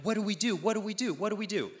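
high-pitched fretting, repeated in a run; hammed-up helpless panic; mock panic. Disciples panicking in the boat over bread, mock panic. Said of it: "then they start panicking".

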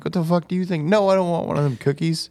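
high, mocking refusal, far up in the voice; sarcastic squeal. Husband mocks refusing wife's cookies, high sarcastic squeal. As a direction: squeak it out in mock outrage.

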